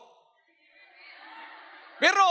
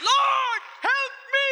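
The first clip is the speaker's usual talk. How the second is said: high loud desperate cry; crying out for help; 5 dB louder, much slower than usual. Acted. Woman begging Jesus for help, high desperate shout. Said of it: "sinabi niya".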